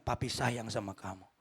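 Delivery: low, soft fatherly voice; tender fatherly affection. Shown as a demonstration. Father hugging departing son, low tender declaration. Models loving words for parents.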